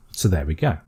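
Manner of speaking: ordinary delivery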